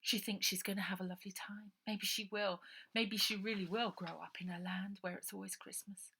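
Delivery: plain reading voice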